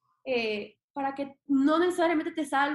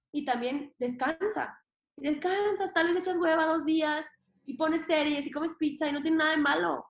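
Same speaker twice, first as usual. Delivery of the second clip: ordinary talk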